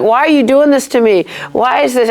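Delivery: high, whining complaint to God; whiny Job. Retold or imitated. Retelling Job's complaint to God, mock whiny and high. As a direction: whine it like a self-pitying Job. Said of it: "he seems kinda whiny".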